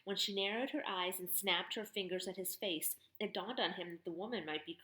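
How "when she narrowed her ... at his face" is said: plain reading voice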